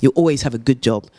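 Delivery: ordinary talk, nothing marked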